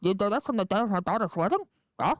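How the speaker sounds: mumbled, garbled godfather impression; hammed-up mafia boss, words swallowed; badly faked film-don voice; Marlon Brando. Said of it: "Do you remember when he was like".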